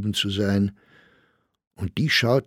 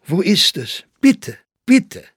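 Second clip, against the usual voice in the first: higher, louder, rising with eagerness; eager pleading; 8 semitones higher, 4 dB louder, slower than usual. Acted. Admirer pleads with the old poet to show his play, excited. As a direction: beg with growing excitement.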